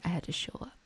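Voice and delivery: ordinary talk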